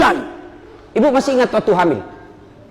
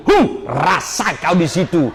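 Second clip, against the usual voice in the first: slow mock gloat; 4 semitones lower, slower than usual. Husband gloating after pushing wife.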